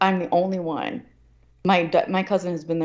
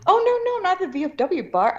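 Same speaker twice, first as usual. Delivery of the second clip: much higher, light mock of the aunt's dismissive denial; imitating aunt; 12 semitones higher than usual. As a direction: toss it off like the aunt brushing her off. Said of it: "aunt answers on the phone".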